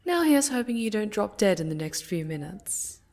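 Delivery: ordinary delivery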